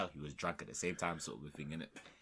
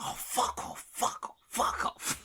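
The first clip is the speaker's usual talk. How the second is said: drawn-out, rasped mock yell; imitating a belligerent fan; imitated drunk outburst; 5 dB louder, slower than usual. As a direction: spit it out slowly like a drunk heckler. Retelling drunk fan's repeated outburst.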